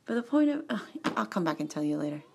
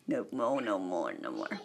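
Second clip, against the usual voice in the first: sinking, softer low muttering; low mock refusal; 12 semitones lower, 7 dB quieter than usual. Refusing to sign life away, low mock muttering. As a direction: sink lower with each refusal.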